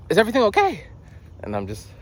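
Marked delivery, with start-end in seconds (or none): 0.1-0.9 high-pitched caseworker check-in; Chloe. Retold or imitated. Caseworker asking Matt once more, high imitation. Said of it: "And she's like".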